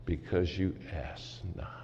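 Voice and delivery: hushed whisper. Acted. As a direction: drop to a whisper to land it. Preacher drives home scripture point.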